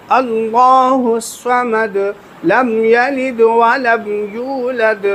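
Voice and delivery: slow, melodic tajweed chant of the whole surah; full recitation. Shown as a demonstration. Teacher recites the whole surah once for students, slow chant. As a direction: recite it slowly and beautifully. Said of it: "ایک بار میں پڑھ کے سنا دیتا ہوں".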